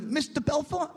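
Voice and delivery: high-pitched questioning Italian voice; little Italian man. Retold or imitated. Little Italian man scampering up to the banker, high-pitched query. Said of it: "an Italian midget".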